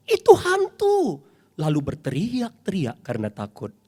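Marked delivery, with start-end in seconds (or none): 0.1-1.2 high, loud, slow scared shout; frightened cry. A narrator's reading. Disciples seeing a ghost, high frightened shout. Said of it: "mereka terkejut dan berseru".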